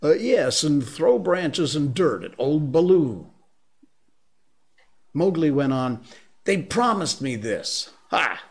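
put-on high, eager boyish voice; a lively jungle boy, pleading and proud; excited man cub. Mowgli's lines, child voice.

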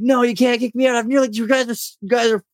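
high, pleading mock protest; desperate protest. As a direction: retell his panic in a squeaky whine. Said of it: "And he's like".

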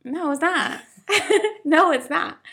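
high, amused objection; laughing protest. Real, not acted. Friend rejecting cohost's approval, laughing protest.

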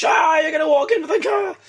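imitating a hysterical passer-by; panicked shout. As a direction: wail a frantic warning, hammed up. Mimics the panicked woman's warning.

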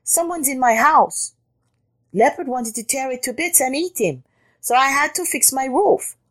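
high-pitched rabbit voice; complaining rabbit. A narrator's reading. Rabbit telling Jackal of the intruder, high complaint. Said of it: "cried the rabbit".

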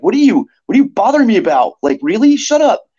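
high-pitched, exasperated mock outburst; mock super mad. Moderator recalling snapping at members who tag them, super mad. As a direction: re-enact being annoyed, pitch climbing to the end. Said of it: "sometimes I get super mad".

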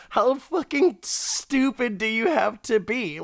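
high, exasperated question; incredulous rant. Real, not acted. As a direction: throw up your hands in disbelief. Ranting about the character.

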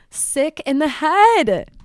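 loud, high, drawn-out self-mockery; dramatic self-roast; exasperated exclamation. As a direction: cry it out slowly in comic disbelief. Mocking her own shopping.

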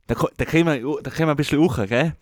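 put-on Tyrolean dialect; Tyrolean accent. Co-host winding up the podcast, Tyrolean accent. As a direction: wrap up like a Tyrolean. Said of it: "noch, noch unser Tiroler".

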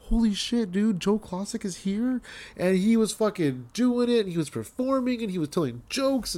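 high-pitched awe without extra volume; awestruck disbelief. Retold or imitated. Fan telling a friend his idol is performing, awestruck.

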